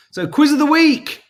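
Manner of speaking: loud, high showman's announcement; quiz announcement. Acted. Host kicking off the quiz for viewers, loud and high. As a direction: belt it out like a game show host.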